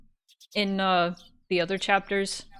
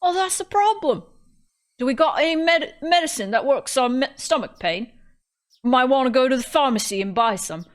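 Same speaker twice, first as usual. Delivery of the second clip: higher-pitched, hammed-up British accent; bad British accent; 5 semitones higher than usual. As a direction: overdo a terrible British accent. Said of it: "my British accent is incredibly bad".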